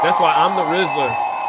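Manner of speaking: shouted boast. Real, not acted. Host boasting to his chat, shouting. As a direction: proclaim it to the crowd.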